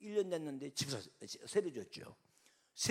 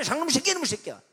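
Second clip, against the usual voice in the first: higher, louder repeated curse; yelled insults; 9 semitones higher, 12 dB louder, faster than usual. Retold or imitated. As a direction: bark the insults again. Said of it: "욕 먹게 돼 있잖아요".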